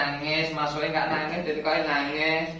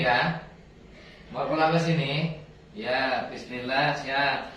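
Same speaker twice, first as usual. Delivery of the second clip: ordinary delivery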